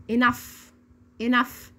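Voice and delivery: "enough" ending in a clear f. Shown as a demonstration. Teacher asks learners to repeat, "enough" ending in f. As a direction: model "enough" for repetition, f at the end. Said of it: "زي صوت حرف الاف بالظبط".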